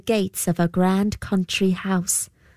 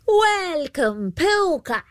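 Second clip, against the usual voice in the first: calling out; 10 semitones higher, slower than usual. Acted. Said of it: "she called out".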